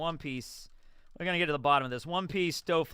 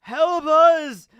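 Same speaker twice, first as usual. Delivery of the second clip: much louder and higher final beg; hammed-up dying cry for help; desperate dying plea; 10 semitones higher, 12 dB louder than usual. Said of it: "members of Cypher Pole are like".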